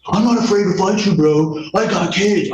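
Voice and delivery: high, strained half-shout; retold standoff. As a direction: relive shouting him down, pitch jumping up. Retold face-off with neighbor.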